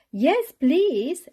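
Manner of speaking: high, loud eager cheer; children cheering yes. A narrator's reading. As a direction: call it out gladly. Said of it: "everyone cheered".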